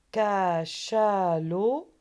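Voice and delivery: Cachalot stretched very slowly and loud; slow syllable demo. Shown as a demonstration. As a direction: sound out Cachalot slowly for children. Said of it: "Donc on n'a pas ça".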